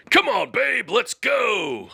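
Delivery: high shouted call; yell. Shown as a demonstration. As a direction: belt it out like a rallying cry. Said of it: "I will yell".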